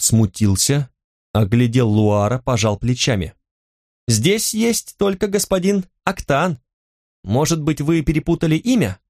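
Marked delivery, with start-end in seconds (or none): 4.1-9.0 put-on higher-pitched boy's voice; politely doubtful, pitched up like a lad; young boy gently querying a customer; puzzled shop boy.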